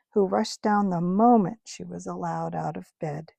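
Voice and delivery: ordinary delivery, nothing marked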